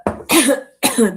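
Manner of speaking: sudden harsh coughing; coughing fit. Real, not acted. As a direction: cough hard, choking on spit. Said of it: "Went down the wrong pipe".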